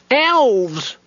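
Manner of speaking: high, alarmed cry. A narrator's reading. Gnome flees to the mouse hole, crying out high. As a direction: yelp it as you flee. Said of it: "With a cry of".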